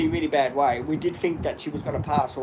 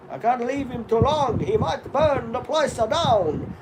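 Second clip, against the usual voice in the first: higher mock father voice, drawn out at the end; imitating his father; 7 semitones higher, slower than usual. Son imitating his father's strong accent for viewers, higher voice. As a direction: put on dad's strong accent, pitched up. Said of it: "This is the way my father talks".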